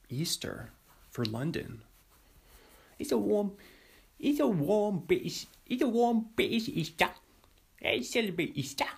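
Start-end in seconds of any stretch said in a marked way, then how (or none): 3.0-8.8 high, badly faked British accent; terrible British accent. Podcaster mocking London's warm Easter, bad British accent. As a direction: put on a silly, high-pitched British accent. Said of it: "that British accent was terrible".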